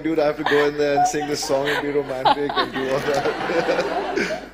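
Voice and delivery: loud high laughing quote; half-laughing panic at the snakes; high, loud and laughing through the words; laughing disbelief. Retold or imitated. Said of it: "And I'm like".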